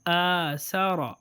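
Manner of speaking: آ سارا drawn out slowly, long vowels held; slow reading demo. Shown as a demonstration. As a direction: stretch آ سارا slowly and clearly. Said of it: "Hamza standing فتحہ".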